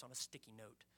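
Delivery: ordinary delivery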